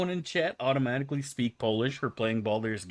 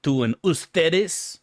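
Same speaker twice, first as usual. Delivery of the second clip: tú and ustedes said slowly and louder; stressed Spanish words; 5 semitones higher, 5 dB louder, slower than usual. Shown as a demonstration. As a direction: lean on tú and ustedes. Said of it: "learned the difference between".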